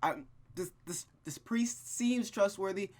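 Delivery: normal talking voice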